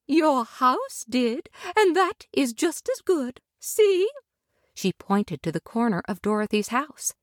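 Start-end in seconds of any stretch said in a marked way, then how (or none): 0.1-4.1 tender lady. Acted. Glinda tenderly welcoming Dorothy to Munchkin Land. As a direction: welcome her gently and graciously.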